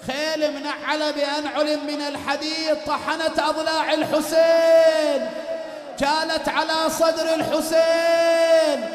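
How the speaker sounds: loud grieving sermon shout; full-force preaching. Acted. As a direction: wail it to the mourners.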